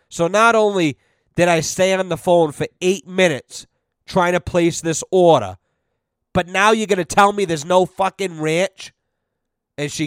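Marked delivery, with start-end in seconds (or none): none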